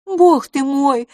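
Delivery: loud, high, drawn-out exclamation; crying out. A narrator's reading. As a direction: cry out in astonishment. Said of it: "вскричала мисс Лафос". (громкий высокий вскрик)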